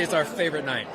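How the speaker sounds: normal talking voice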